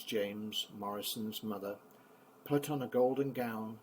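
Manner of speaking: ordinary delivery, nothing marked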